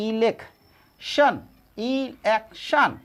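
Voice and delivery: इलेक्शन stretched slowly, syllable by syllable; slow pronunciation. Shown as a demonstration. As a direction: say इलेक्शन slowly for students to copy. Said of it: "तो यहाँ क्या है".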